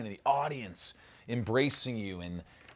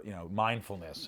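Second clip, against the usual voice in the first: ordinary delivery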